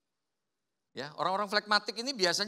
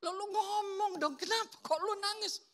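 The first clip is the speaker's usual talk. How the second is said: pleading friend; 3 dB quieter than usual. Acted. Friend urging melancholic person to explain crying, high and pleading. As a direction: coax them to talk.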